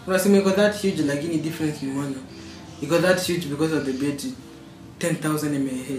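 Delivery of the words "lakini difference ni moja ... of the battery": ordinary delivery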